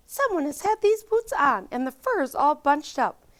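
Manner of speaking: high, fussy mother bear; a high, put-out Mama Bear; high-pitched fussy mother bear. A narrator's reading.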